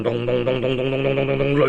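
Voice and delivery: rapid chanted drum sounds; drum beats. Acted. Storyteller imitating Lü Bu's war drums, fast rhythmic beats. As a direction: rattle off the war drums quickly.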